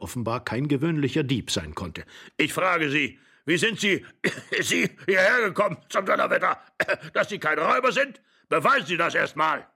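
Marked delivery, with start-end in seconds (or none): 2.4-9.7 put-on high, agitated old man; irate, worked-up interrogation; spluttering anger. A narrator's reading. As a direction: demand answers, coughing with outrage.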